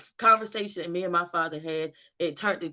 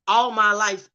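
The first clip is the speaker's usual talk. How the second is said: bursts out louder, voice straining with grief; anguished outburst; 4 semitones higher, 8 dB louder than usual. Retold or imitated. To her brother, learning of his abuse: anguished cry. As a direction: let the apology break out of you.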